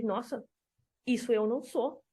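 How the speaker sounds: soft, stunned disbelief; hushed, reading about herself; shocked denial. Retold or imitated. To her boyfriend, a soft shocked denial. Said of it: "eu falei".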